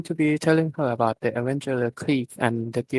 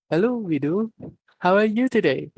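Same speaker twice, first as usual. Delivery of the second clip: a touch of robot voice, higher; robotic; 7 semitones higher than usual. A narrator's reading. Android Nero greeting Widow, lightly robotic. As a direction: stiff machine greeting. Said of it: "she says robotically".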